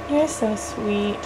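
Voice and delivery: soft cooing. Acted. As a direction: hush it down sweetly to the baby. Mom cooing to baby.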